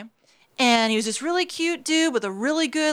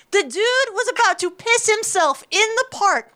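outraged disbelief; 7 semitones higher than usual. Real, not acted. Outraged retelling.

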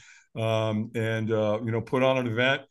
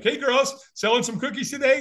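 put-on bright, much higher voice for the girls; friendly man to kids; 11 semitones higher than usual. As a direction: talk up cheerfully to little girls. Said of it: "and he's like".

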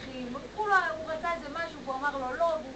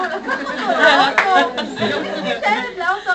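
loud, slow, laughing telling; amazed laughing storytelling; laughing in disbelief; 5 semitones higher, 14 dB louder than usual. Real, not acted. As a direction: marvel at it through laughter.

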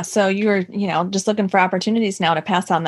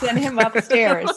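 laughing echo; slower than usual. Real, not acted.

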